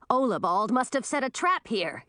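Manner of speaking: ordinary delivery, nothing marked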